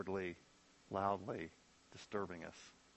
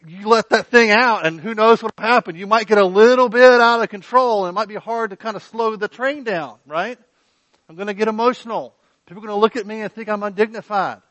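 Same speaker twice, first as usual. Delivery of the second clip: louder, higher, rising preacher's fervor; preaching at full force; 8 semitones higher, 13 dB louder than usual. Acted. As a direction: build it up like a revival preacher.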